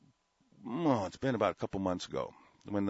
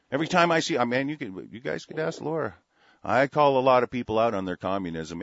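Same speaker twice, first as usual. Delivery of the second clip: ordinary delivery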